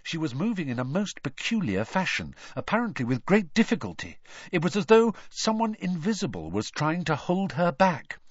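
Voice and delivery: ordinary delivery, nothing marked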